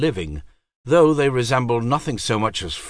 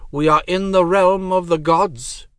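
high, cold divine voice; goddess voice; 10 semitones higher, 6 dB louder than usual. Acted. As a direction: speak as an aloof goddess. Goddess explains.

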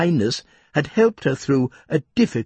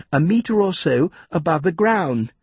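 ordinary delivery, nothing marked

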